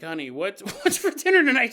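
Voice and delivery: high, fast cheery husband with a laugh; mock cheerful husband asking what's for dinner. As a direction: chirp it out like a sitcom dad. Husband voiced asking about dinner.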